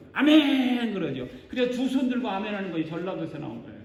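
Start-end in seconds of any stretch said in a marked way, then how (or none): 0.1-0.9 loud, higher, imitated zealous amen; mock fervent congregational cry; hands-up amen. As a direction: belt it out like an eager worshipper.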